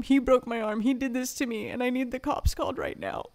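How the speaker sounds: urgently reporting what was done; urgent plea. Retold or imitated. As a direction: press for help, voice lifted. Retold plea for police help.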